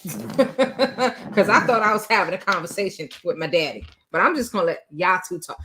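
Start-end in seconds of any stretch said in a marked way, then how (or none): none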